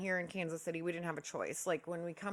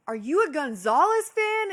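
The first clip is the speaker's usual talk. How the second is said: loud, higher yell across the courtyard; yelled question; 7 semitones higher, 9 dB louder than usual. Retold or imitated. Streamer asking the neighbor across the courtyard, loud shout. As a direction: shout the question over to her. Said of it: "screaming across the courtyard at each other".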